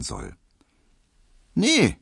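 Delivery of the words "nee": high, sharp little no; pointed. A narrator's reading. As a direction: snub him with one word. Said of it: "sagte Hedwig spitz".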